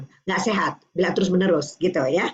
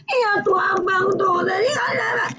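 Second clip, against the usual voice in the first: put-on high, slow whiny sob of a little kid; crying child; 12 semitones higher, slower than usual. Mother imitating her child complaining about Abang through tears, high sobbing whine.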